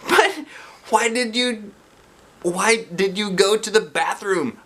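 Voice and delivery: put-on high, slow puzzled kid voice. A J asking Emily why she left, puzzled. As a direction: wonder aloud.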